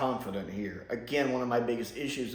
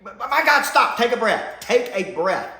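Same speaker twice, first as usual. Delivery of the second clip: higher and louder, begging her to stop; exasperated plea; 6 semitones higher, 6 dB louder than usual. Retold or imitated. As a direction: tell the hyper doctor to calm down. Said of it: "I'm sitting going".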